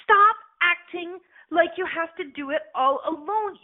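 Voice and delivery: ordinary talk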